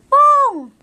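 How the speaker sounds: high, sharp call; game shout. Shown as a demonstration. Teacher showing the seeker's call on catching a friend, high game shout. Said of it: "sisigaw ng".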